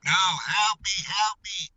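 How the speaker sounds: hammed-up help from a well; mock cry for help. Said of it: "Am I in the bottom of a well still?".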